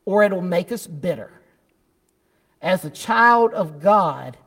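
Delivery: ordinary talk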